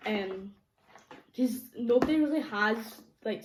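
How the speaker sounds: ordinary talk, nothing marked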